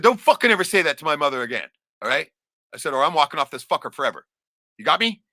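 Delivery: loud, high ultimatum; retold angry threat. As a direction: lay down the law loudly. Son threatens father after insult.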